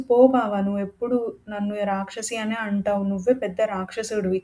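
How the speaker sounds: ordinary delivery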